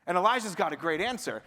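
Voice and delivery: ordinary talk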